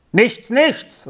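calling outside. A narrator's reading. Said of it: "rief Vinzenz hinaus".

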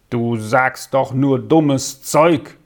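slow, dismissive put-down; scornful call. A narrator's reading. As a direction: draw it out with scorn. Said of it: "rief der Stöffi dem glücklichen Sami zu". (langsam und abfällig zugerufen)

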